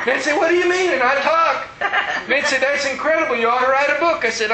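can't stop laughing while telling it. Real, not acted.

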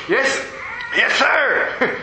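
high, hurried mock-fearful yes sir; nervous yes sir. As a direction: play it as a half-joking, jumpy yes sir to a scary man. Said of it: "And I'm going".

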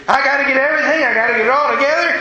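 imitating a flustered mother, high and hurried; in a tizzy. Mother rushing before the store closes, in a mock tizzy. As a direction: play up a mock last-minute panic. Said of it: "she got in a tizzy".

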